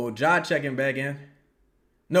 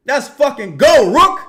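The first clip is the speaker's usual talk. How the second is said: loud, hyped cheer; excited cheer; 8 semitones higher, 12 dB louder than usual. Real, not acted. Fan cheering Rook's play from the couch, loud and hyped. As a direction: celebrate the play at full voice.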